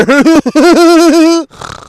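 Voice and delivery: high loud cackle; high loud cackling laugh. Real, not acted. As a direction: burst out laughing. Laughing at trailer line.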